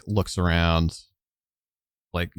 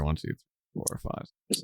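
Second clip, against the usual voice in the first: muttered count, fading; counting under breath; 3 semitones lower, 11 dB quieter, faster than usual. Acted. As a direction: count quietly to yourself. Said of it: "counting men under breath".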